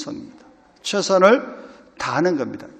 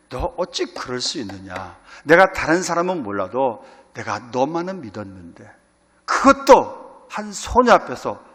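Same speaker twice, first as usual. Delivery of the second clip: mock scolding of Peter rising to a loud end; half-acted disappointed master; imagined rebuke; 7 dB louder than usual. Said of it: "그런 말씀, 그럴 만도 하시잖아요". (실망한 주인의 꾸짖음 흉내)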